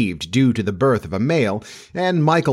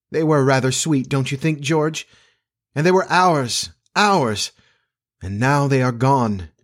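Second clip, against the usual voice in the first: higher-pitched, plaintive mother; mildly acted sorrow for missing children; wistful grief; 3 semitones higher than usual. A narrator's reading. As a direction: let the longing rise, then settle. Grieving mother recalls her lost children.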